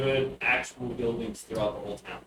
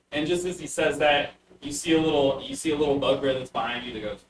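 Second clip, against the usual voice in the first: ordinary talk, nothing marked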